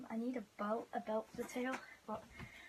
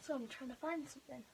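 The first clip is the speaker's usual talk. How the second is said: ordinary talk, nothing marked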